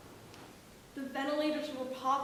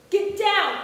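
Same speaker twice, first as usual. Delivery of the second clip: shouting; 6 semitones higher, 12 dB louder than usual. Retold or imitated. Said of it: "all I could hear was".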